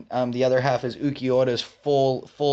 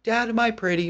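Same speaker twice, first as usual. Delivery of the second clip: put-on high sweet little girl voice; 9 semitones higher than usual. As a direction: play the small daughter asking sweetly. Retelling a TikTok, child voice.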